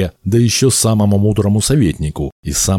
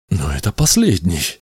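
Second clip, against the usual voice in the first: protesting exclamation; 8 semitones higher than usual. A narrator's reading. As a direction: start hesitantly, then exclaim in protest. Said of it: "воскликнул Ктесфион". (протестующее восклицание, с нарастанием)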